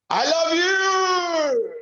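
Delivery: high, slow, shouted love you. Shown as a demonstration. Teacher shows a loud angry I love you. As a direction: yell it out with full force. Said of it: "Same words, two different meaning".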